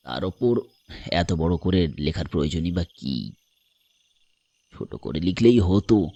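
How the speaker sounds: deep, solemn stranger voice; grave. A narrator's reading. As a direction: pronounce judgement gravely in a low voice. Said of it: "লোকটা গম্ভীর গলায় বলল". (গম্ভীর গলায়)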